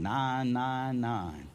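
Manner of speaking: slow, drawn-out "nine nine nine"; Herman Cain catchphrase. Acted. Preacher echoing a politician's slogan, "nine nine nine" slowly drawn out. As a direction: stretch each "nine" in "nine nine nine". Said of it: "Feel like Herman Cain".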